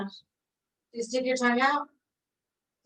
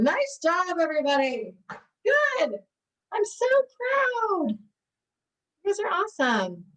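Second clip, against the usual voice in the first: excited praise; 7 semitones higher than usual. Real, not acted. Praising the class at home.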